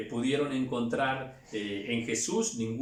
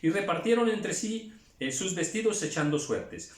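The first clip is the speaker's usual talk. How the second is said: usual speaking voice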